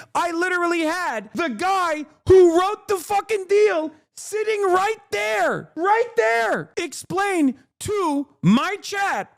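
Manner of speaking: venting at the chat, pitch shooting up; yelling at chat. Real, not acted. Streamer yelling at a chatter over a repeated question, heated. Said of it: "I apologize for yelling at you".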